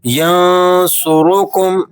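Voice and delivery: measured, melodic tajweed recitation; Quran recitation. Acted. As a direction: recite the verse slowly and melodically. (تجوید کے ساتھ تلاوت)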